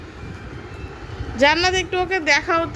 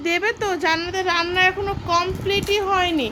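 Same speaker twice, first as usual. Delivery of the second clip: normal talking voice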